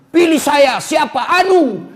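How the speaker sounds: comic politician shouting; mock candidate. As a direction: ham up a nameless candidate. Mocking candidate who has no name.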